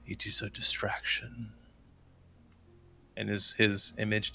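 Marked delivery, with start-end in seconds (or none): 0.1-1.5 soft, hushed dragon voice; deep dragon. Acted. Dragon god gives a last warning before vanishing, deep dragon voice. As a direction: speak quietly as an ancient dragon. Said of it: "as deep of a, a dragon voice as he can".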